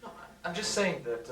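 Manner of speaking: high laugh into his words; laugh, then keep talking through it. Acted. Guest laughing at the joke about his own remark.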